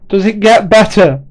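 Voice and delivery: very high, loud pleading scream. Acted. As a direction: scream the question down the road. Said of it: "he screamed".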